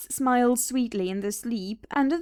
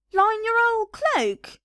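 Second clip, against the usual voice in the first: much higher, louder, slow outraged shout; McGregor shouting; 10 semitones higher, 5 dB louder, slower than usual. Acted. McGregor shouting at his wife over her cloak plan, outraged. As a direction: bellow in disbelief. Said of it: "shouted Mister McGregor".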